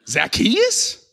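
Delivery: high, rising, disbelieving question; incredulous disgust. Acted. Crowd member scoffs at the chosen man, incredulous. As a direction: repeat the name in mock disbelief.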